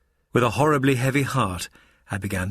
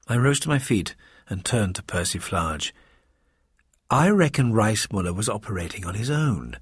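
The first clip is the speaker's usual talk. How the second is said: plain reading voice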